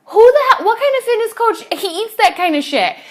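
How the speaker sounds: very high, loud laughing disbelief; real incredulous laugh-shout; laughing exasperation. Real, not acted. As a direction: laugh at your own absurdity.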